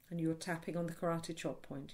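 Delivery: usual speaking voice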